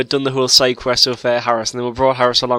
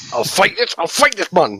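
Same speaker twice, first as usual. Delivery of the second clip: higher, put-on fighting talk; mock defiant challenge; 6 semitones higher than usual. Player mimicking someone squaring up to a dragon, mock defiant. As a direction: imitate a blustering challenger.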